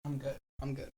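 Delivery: trying to compose yourself; holding back tears. Real, not acted. Friend crying after heartfelt words, softly choked up. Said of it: "Are you crying?".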